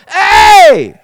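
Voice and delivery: sudden loud high shout. Retold or imitated. Preacher imitating God speaking up, a loud shout. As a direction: bark it like God calling out. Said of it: "if he would just go".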